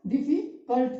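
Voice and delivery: Difficult in two beats, डिफि then cult; syllable break. Shown as a demonstration. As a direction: leave a gap before cult in difficult. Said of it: "दो syllables हो रहे हैं ना". (Difficult को रुककर, डिफि और cult)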